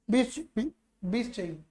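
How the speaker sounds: very soft, hesitant answer; acted timid reply. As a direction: play it meek, barely getting the words out. (दबी, झिझकती आवाज़ का अभिनय)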